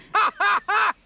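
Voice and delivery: high, loud villain cackle; mock gloating laugh. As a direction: cackle in hammed-up triumph. Vizzini's gloating laugh acted out.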